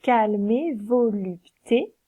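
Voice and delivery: slow, every syllable apart; slow scansion. Shown as a demonstration. Teacher scans luxe calme et volupté slowly. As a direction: spell out the rhythm slowly. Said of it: "on va dire".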